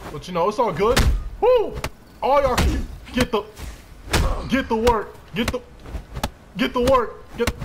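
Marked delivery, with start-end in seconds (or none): 1.4-1.7 very high, loud "woo"; excited whoop. Real, not acted. Fighting in the game. 2.2-7.5 hyped taunt. Real, not acted. Taunting several attackers.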